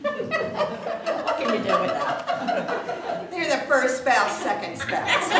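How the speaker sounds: words squeezed out through laughter; giggling at the spouse list; laughing. Real, not acted.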